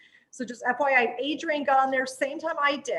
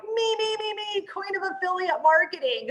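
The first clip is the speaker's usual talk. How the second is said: excited; 6 semitones higher than usual. Retold or imitated. Eagerly volunteering for the program.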